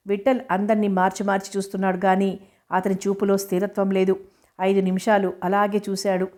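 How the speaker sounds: ordinary delivery, nothing marked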